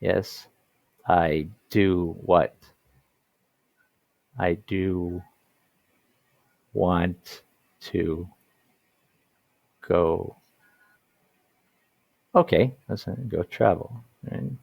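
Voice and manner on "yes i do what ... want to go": slow "I do want to go", each word set apart by pauses; emphatic do. Shown as a demonstration. Teacher modelling a keen answer to a date question. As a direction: spell it out slowly, leaning on "do". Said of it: "you wanna show emphasis, so you're gonna add "do".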